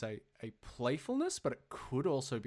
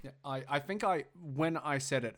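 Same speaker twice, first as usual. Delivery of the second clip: ordinary delivery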